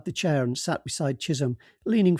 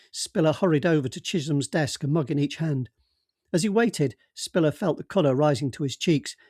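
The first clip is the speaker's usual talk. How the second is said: ordinary delivery, nothing marked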